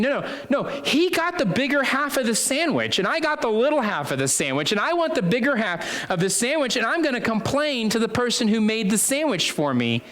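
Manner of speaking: put-on high, childish protest over the sandwich; whiny child. Child complaining about the smaller half of sandwich, high whine. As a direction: play a child stamping for the bigger half.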